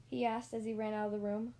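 usual speaking voice

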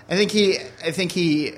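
usual speaking voice

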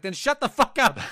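loud, high outburst; mock yelled comeback. Gamer retelling a comeback to a toxic player, mock yell. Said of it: "whenever I get yelled at".

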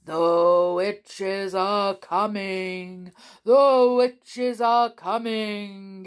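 very slow, drawn-out eerie warning; deep grumbling wind voice. A narrator's reading. Voice in the wind warns of witches, deep grumbling. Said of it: "in a deep down grumbling sort of voice".

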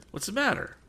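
high, urgent call; alarmed cry. A narrator's reading. Tom running back, crying out in alarm. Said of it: "he cried".

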